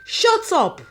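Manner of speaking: high, loud screamed command. Acted. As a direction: scream it to silence her. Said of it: "Amara screamed".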